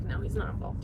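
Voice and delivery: a hushed voice talking herself out of a fear; whispered inner doubt. Retold or imitated. Woman repeats her inner voice denying husband's involvement, whispered. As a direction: whisper it to yourself, unvoiced and soft.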